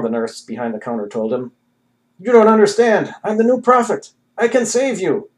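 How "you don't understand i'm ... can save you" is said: high, loud, desperate insistence; frantic pleading. A narrator's reading. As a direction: beg her to listen, feverish. Frantic prophet pleads with nurse.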